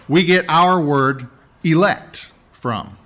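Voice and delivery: ordinary delivery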